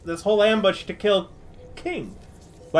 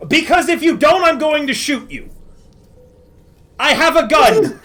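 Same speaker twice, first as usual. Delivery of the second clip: raised, heated threat at gunpoint; angry threat; 4 semitones higher, 4 dB louder than usual. Acted. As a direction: snap the threat at them, louder.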